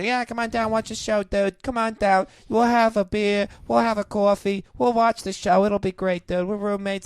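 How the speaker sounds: high-pitched dude roommate voice; sustained high, coaxing dude-bro invitation; Cole impression. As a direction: put on a high roommate voice, cajoling. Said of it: "That's exactly how he talks".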